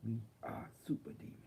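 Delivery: fast menacing whisper. Pretend. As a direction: hiss it like a secret demon creed. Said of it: "the super demon force".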